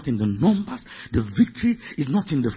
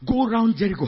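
ordinary talk, nothing marked

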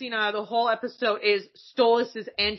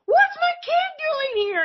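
high, drawn-out parent squeal; excited parent; 4 dB louder, slower than usual. Acted. Any parent spotting their kid, high excited squeal. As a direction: coo it high and slow, like a proud parent. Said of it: "that's any parent. You're just like".

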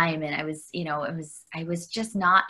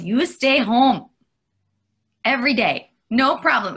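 mother's voice; 6 semitones higher, 7 dB louder than usual. Retold or imitated. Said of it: "she goes".

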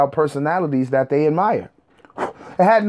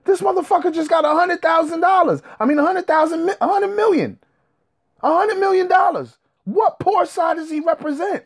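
high, incredulous, exasperated retort; disbelieving outrage, voice pitched way up; furious high-pitched "what are you talking about"; 13 semitones higher than usual. Retold or imitated. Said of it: "I'm like".

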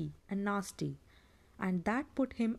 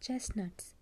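whispered hiss, softer; 5 dB quieter than usual. Acted. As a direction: breathe it out as a hiss. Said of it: "he hissed".

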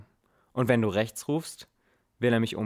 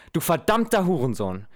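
high, loud enraged yell at his son; furious shout; 7 semitones higher, 6 dB louder than usual. Acted. Father screams at his son over David, furious. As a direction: spit the insult out in rage.

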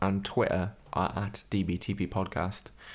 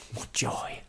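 sarcastic whisper; 4 dB quieter than usual. Real, not acted.

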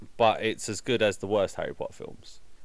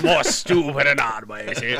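mock Hatch voice; 5 dB louder than usual. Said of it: "he would have thought this was nonsense".

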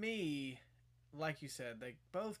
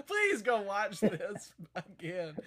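after laughing hard; 4 semitones higher, 8 dB louder than usual. Real, not acted. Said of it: "I'm crying laughing about this now".

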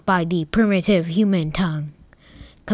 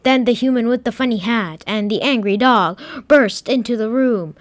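ordinary delivery, nothing marked

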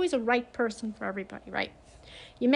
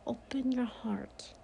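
soft slow whisper, no voice; whispered plea; 8 dB quieter, slower than usual. Retold or imitated. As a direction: breathe it like a secret, slowly. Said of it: "But just do yourself a favor".

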